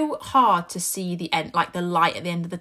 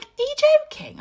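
very high-pitched incredulous question; exasperated disbelief; 3 dB louder than usual. Retold or imitated. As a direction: retell it as a squeaked protest. Parents' disbelief at sobbing child.

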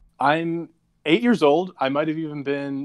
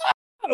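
retold scream. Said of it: "I went".

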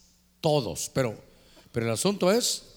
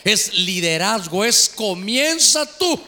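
slow, loud, drawn-out proclamation; slow emphatic stress; 3 semitones higher, 6 dB louder, slower than usual. Real, not acted. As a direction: hammer each word home slowly. Pastor stressing key point. (énfasis lento y fuerte)

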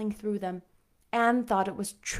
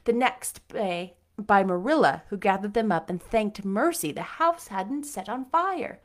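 ordinary delivery, nothing marked

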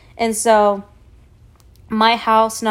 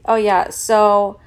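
ordinary delivery

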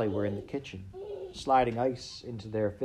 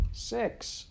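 rough beetle voice; 12 semitones higher than usual. A narrator's reading. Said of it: "in its rough voice".

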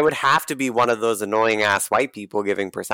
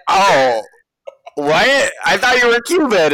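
surprised Winter; 7 semitones higher, 4 dB louder than usual. Retold or imitated. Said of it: "Winter was like".

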